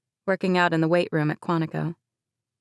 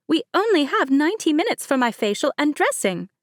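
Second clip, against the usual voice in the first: demanding bride; 9 semitones higher than usual. A narrator's reading. Bride Mercedes confronts late cousin Chloe, demanding. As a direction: insist on an answer, stretched out. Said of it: "Mercedes demanded".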